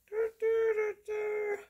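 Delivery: high falsetto du du like a whistle; whistle noise. Pretend. Mimicking a whistle to the viewers.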